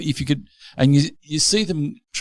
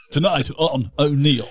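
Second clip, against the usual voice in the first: mock TV host intro; 4 semitones higher, slower than usual. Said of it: "Like a Hinch".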